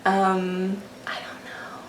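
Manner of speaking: whispered shrug of a line; whispering. Pretend. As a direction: keep it breathy and voiceless. Whisper continues after joke.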